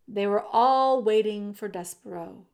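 usual speaking voice

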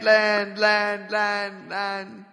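slow words fading into an echo; mock echo. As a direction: let land echo away, fading.

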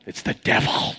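hushed whisper of doom; spooky whisper. Retold or imitated. Preacher mocking others' judgment, spooky whisper. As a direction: whisper it like a ghost story. Mock verdict on someone else.

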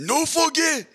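high squeezed impression; Yoel Romero impression. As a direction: mimic the fighter's rough line. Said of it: "Oh, good. That was hilarious".

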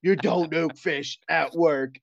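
angry coworker laying down the law; mock lecture. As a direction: scold the fish nuker, hammed up. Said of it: "made, like, a scene".

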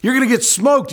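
high, loud boastful jeer; swaggering taunt aimed at Elijah; big-talking taunt. Retold or imitated. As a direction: brag like a playground bully. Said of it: "They were big talkers".

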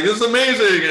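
high, loud burst of joy; exhilarated shout from a hang glider; thrilled dad. Retold or imitated. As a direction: cry it out as you leap off the mountain. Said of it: "He's like".

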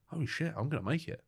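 high-pitched, hushed burst of hope; retold amazed realisation. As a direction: relive the sudden realisation, voice jumping up. Retelling sudden realisation he will make it.

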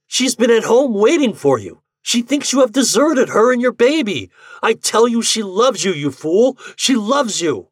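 high, loud, pleading protest; impassioned cry. A narrator's reading. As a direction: plead at the top of your pitch. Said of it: "he cried".

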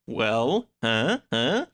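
ordinary delivery

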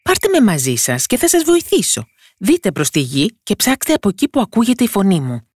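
high little voice calling up; mild high-pitched shout. A narrator's reading. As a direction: call for attention as a tiny character. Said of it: "φώναξε πάλι".

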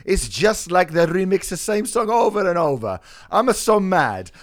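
high, loud, hammed-up Italian accent; mock Italian fan ranting about his money. Reading an angry fan review in a comic Italian accent. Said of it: "I need to read this in an Italian accent".

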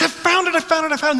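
high, bright squeal of I found it; high excited mock cry; excited wife. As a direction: repeat it like a thrilled wife. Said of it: "she's like".

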